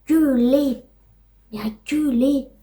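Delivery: imitating the car's noise. Vlogger mimics her sister's car sputtering at ninety, mock noise. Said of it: "elle se met à".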